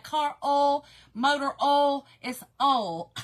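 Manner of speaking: "oil" said loudly as "ol"; Southern oil vowel. Shown as a demonstration. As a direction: drive home "oil" as one syllable, no "oy". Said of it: "It's like, oh".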